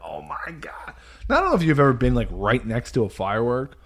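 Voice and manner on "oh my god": awed whisper. Retold or imitated. Awed by fireworks overhead.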